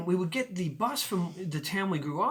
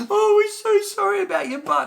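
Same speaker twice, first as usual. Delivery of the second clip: put-on much higher, loud girly apology; hammed-up sweet apology; mock girl voice; 11 dB louder than usual. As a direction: bikini girls apologizing to the bitten boy, high mock voice. Girls apologize, voiced high.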